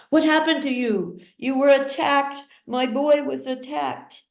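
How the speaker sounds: high, rushed, frightened questions; alarmed mother. A narrator's reading. Mother frightened by her son's bloody clothes. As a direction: panic rising over her hurt boy.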